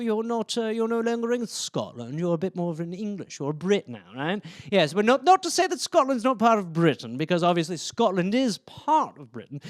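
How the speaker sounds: posh English accent; posher British accent. Shown as a demonstration. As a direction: talk like a well-to-do Brit. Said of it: "then you go a bit posher".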